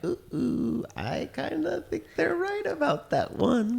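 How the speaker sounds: squeaky reluctant admission. Retold or imitated. Imagined person admitting Republicans right.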